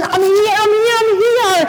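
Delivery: high, urgent calling out; retold screaming. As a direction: yell to be noticed by a loved one. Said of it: "You're screaming your head off".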